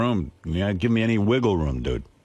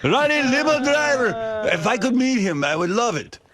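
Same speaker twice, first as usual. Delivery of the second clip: put-on high, slow, thrilled Italian accent; hammed-up Italian fan savoring the name. Salesman hoping to meet the limo driver, slow thick Italian accent. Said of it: "he had some foreign accent".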